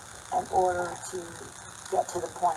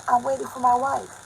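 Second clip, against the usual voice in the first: raised, higher mock yell; retold shout, pitched up; mimicked retort; 7 semitones higher, 7 dB louder than usual. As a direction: imitate a stranger snapping back loudly. Rude stranger's answer acted out again.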